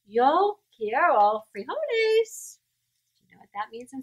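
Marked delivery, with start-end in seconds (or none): none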